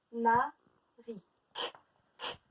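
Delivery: "Canari" slowly by syllable, then bare "K, k" consonants; slow word followed by isolated k sounds; sounding out k. Shown as a demonstration. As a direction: draw out "Canari", then pop the lone "k". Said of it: "Où est-ce qu'on entend le son".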